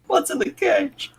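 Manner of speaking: squeaky voice. Pretend. Players guess the caged creature.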